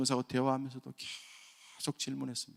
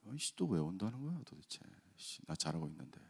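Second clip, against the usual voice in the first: muttered under the breath, much softer; retold irritated muttering, lower and softer; grumbling mutter; 5 semitones lower, 12 dB quieter than usual. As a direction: grumble to yourself, annoyed. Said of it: "막 이러면서".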